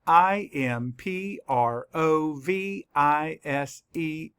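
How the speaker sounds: slow, letter-by-letter spelling; measured classroom spelling; spelling aloud. Shown as a demonstration. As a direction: spell it out slowly, each letter separate. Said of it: "Let's spell it".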